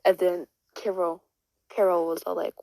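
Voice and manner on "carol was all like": ordinary delivery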